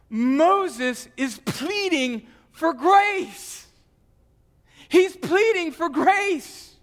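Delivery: slow, high, loud pleading preacher; fervent climax. Acted. Pastor urging the congregation that Moses pleads for grace, impassioned. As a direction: cry it out, begging for grace.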